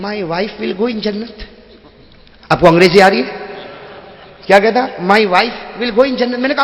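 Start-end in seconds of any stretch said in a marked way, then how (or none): 0.1-1.4 put-on slow, softer British-tinged young man; young English-speaking man. Young man asking the maulana, slow and soft. 4.8-6.4 put-on slow, softer British-tinged young man; young English-speaking man. Young man asking the maulana, slow and soft.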